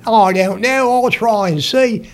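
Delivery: put-on English accent, pitched high. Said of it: "Can you do an English accent".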